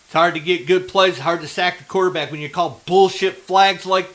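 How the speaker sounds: loud, bitter fan rant; angry rant. Real, not acted. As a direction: grumble hard at the bad flags. Still ranting about the refs' call.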